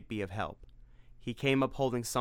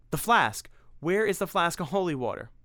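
frantic search; 7 semitones higher, 4 dB louder than usual. Acted.

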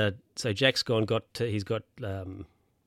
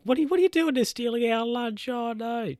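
put-on high, indignant old-lady cry; outraged old ladies; 13 semitones higher than usual. Ladies protesting the guard taking lunch, high and outraged. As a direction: squawk like outraged grannies. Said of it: "they're like".

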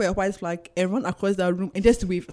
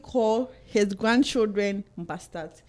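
ordinary talk, nothing marked

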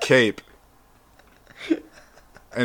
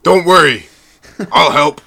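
heroic, louder and higher; superhero voice; 4 semitones higher, 8 dB louder than usual. Pretend. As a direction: reassure like a caped hero. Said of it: "That's my superhero voice".

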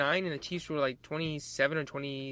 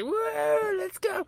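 pitched way up, cracking hype yell; mock hype cheer; 4 dB louder, much slower than usual. Fan cheering the Super Bowl, hammed-up excitement. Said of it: "I was gonna say".

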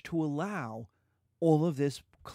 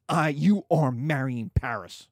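louder, hammed-up furious father; father forcing Juliet to marry Paris; mock angry Capulet; 6 dB louder than usual. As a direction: put your foot down hard. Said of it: "where Capulet says".

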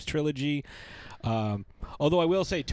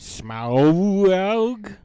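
slow, loud, higher dragon rumble; 6 semitones higher, 9 dB louder than usual. Pretend. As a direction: drag it out like Smaug the dragon. Naming Cumberbatch's dragon role.

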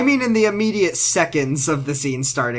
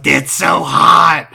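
slow, leering creep voice; drawn out like a sleazy creep; sleazy pickup line; 2 semitones higher, 8 dB louder, much slower than usual. Retold or imitated. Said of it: "creep voice".

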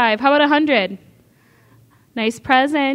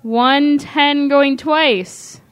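auctioneer's close; 4 dB louder, much slower than usual. Acted. Auctioneer closing the sale.

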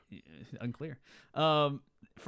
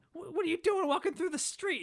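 very high-pitched put-on Irish accent; mock Irish cop. Traffic cop stops Frosty in the street, mock Irish accent. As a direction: challenge them as a cartoon Irish policeman. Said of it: "he's, like, this Irish guy".

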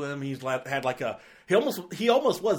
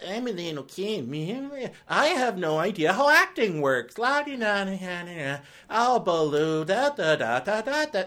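a lightly voiced, higher clueless kid; mocking little kid; 5 semitones higher than usual. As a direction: mimic a bad child actor, high and sing-song. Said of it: "the kid in Favreau's Jungle Book was like".

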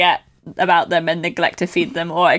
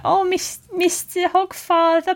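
put-on high, slow lisping little girl; cute kid voice with fake lisp; 11 semitones higher, slower than usual. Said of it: "Being like".

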